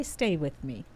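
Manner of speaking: ordinary delivery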